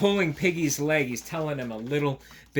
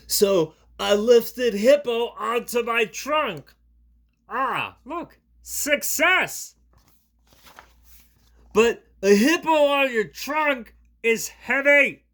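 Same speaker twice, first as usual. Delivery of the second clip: louder, higher, slow straining elephant; Gerald strained; 10 semitones higher, 10 dB louder, slower than usual. Acted. Gerald tells Piggy his story, louder straining voice. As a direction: heave the words like lifting. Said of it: "Gerald thinks".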